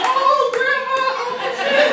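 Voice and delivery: very high, loud hype yell; excited cheering. Retold or imitated. Grandson seeing grandma start dancing, excited high cheering. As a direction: whoop in amazed delight.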